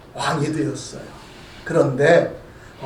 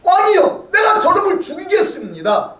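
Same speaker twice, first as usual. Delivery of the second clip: angry outburst; 7 semitones higher than usual. Acted. The general offers the king to kill Shimei, with an angry outburst. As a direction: burst out at the king, fuming. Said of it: "얼마나 화가 났으면".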